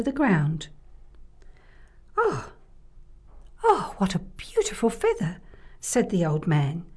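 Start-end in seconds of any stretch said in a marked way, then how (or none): 2.2-5.4 lightly voiced old man, high exclamation; a hint of an amazed old man. Old man marvels at feather.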